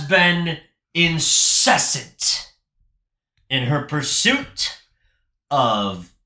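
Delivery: slow, low, portentous movie-trailer read; mock-epic narrator introducing the villain; dramatic trailer narration. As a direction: intone it like an epic voiceover.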